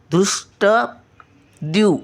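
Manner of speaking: धृष्ट drawn out, ष joined to ट; slow syllable demo. Shown as a demonstration. Grandmother breaking धृष्ट apart slowly for children. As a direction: sound out धृष्ट slowly and high. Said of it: "मचा उच्चार".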